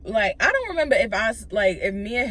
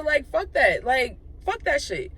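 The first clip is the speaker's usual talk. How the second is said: ordinary talk, nothing marked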